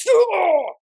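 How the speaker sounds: high, loud frustrated groan; exasperated ugh. Retold or imitated. A woman in labor groaning at her midwife's warning. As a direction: let out a sharp disgusted ugh. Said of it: "I was like".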